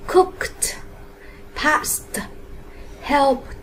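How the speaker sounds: "cooked", "past", "helped" each ending in a separate puff of t; final t sound. Shown as a demonstration. Teacher gives examples of the t ending, "cooked", "past", "helped" with released t. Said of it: "thì chúng ta đọc là âm t".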